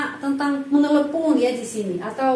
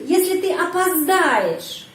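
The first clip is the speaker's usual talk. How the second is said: опоздаешь lifted high, then dropping; question stress on verb; 4 semitones higher, slower than usual. Shown as a demonstration. Teacher demonstrating question intonation for learners, stress on опоздаешь. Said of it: "juga penekanan pada kata kerja".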